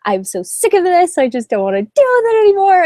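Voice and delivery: high-pitched, fed-up whine retold; mock tearful complaint. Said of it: "I cried a little bit and was like".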